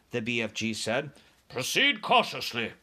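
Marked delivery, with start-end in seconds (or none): 1.5-2.7 lightly voiced wary officer; cautious order. A narrator's reading. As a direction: give a careful, measured command. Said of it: "the army man ordered".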